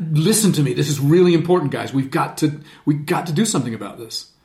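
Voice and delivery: rally them with intense urgency; insistent fire. Shown as a demonstration. Leader urging the guys to act, fiery and insistent. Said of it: "that insistent voice of absolute fire".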